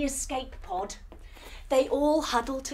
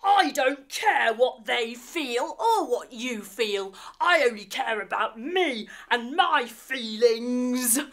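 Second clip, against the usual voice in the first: slow sneering alien voice; a spoiled baddie who only cares about himself; selfish villain. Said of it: "Snarge jumps wide and says".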